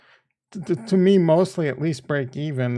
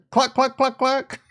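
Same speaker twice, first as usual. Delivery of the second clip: chicken clucks; 8 semitones higher than usual. Pretend. Imitating chicken again.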